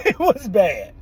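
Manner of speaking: laughing. Real, not acted. Repeats it while laughing.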